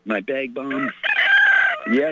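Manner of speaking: usual speaking voice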